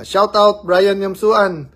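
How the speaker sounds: loud, high, drawn-out calling out of a name; excited shout-out. Real, not acted. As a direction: call his name out big and slow like a happy greeting. Livestream shout-out to a birthday friend. (masiglang pagsigaw ng pagbati)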